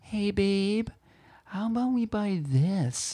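hammed-up begging for a purchase; imitating partner's wheedling. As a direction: coax sweetly in a put-on partner voice. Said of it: "exactly what I sound like".

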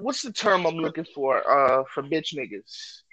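ordinary delivery

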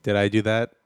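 lightly voiced Urkel nerd; a hint of Urkel's whine; mild Urkel impression; Urkel catchphrase. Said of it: "See what I did there?".

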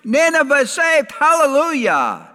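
high, loud cry of hallelujah; exultant preacher's shout, pitched high; jubilant shout. Acted. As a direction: proclaim it like triumphant good news. Acts a rejoicing prophet, then denies it.

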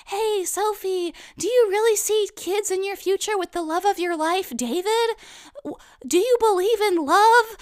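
pry sweetly into her love life; strange woman's question. Pretend. Stranger asking Kaylee about kids, high and prying. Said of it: "some strange woman is like".

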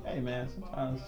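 ordinary talk, nothing marked

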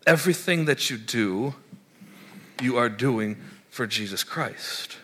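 ordinary talk

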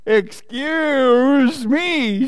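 high, slow, drawn-out storybook shout; indignant shout. A narrator's reading. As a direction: stretch it out in outraged protest. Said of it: "shouted the rabbit".

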